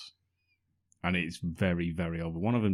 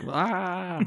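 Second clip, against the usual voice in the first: mock scream; 11 semitones higher than usual. Mock wedding guest scream.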